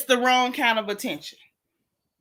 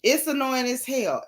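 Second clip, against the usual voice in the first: normal talking voice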